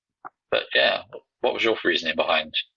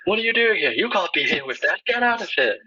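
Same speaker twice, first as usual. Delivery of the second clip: mock referee; 14 semitones higher than usual. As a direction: play a tiny fussy referee yelling. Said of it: "Get a ref just like".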